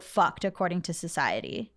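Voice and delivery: ordinary talk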